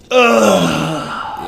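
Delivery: long, drawn-out groan of disgust; real "ugh" of distaste. Real, not acted. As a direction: groan slowly in revulsion. Disgust at the retold scene.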